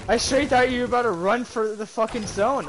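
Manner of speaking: telling it big, still laughing inside; excited loud retelling. Real, not acted. To teammate about the zone run, excited and loud.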